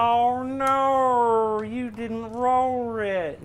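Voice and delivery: very high, loud, slow Aussie "oh no" drawn out; exaggerated Aussie accent. Teasing his opponent's dice roll in a hammed-up Australian accent. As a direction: stretch it like a sing-song Australian. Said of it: "That's my favorite part of the accent".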